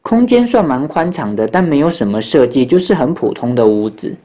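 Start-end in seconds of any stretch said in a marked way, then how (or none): none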